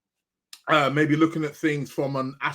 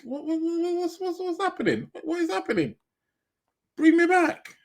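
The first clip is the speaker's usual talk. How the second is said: high, bewildered pleading; lost and baffled; confused; 13 semitones higher than usual. Real, not acted. Stream failing, confused.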